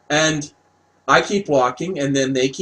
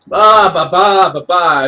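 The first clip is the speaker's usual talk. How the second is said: loud, high "ba-ba-ba" babble; imitated attack noise; 3 semitones higher, 6 dB louder than usual. Attacked by flapping birds in the story, loud mock babble. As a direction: burst out with mock-panicked noises.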